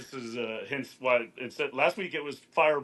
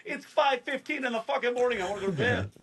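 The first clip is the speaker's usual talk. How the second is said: exasperated; 11 semitones higher than usual. Retold or imitated. Exasperated reply to wife.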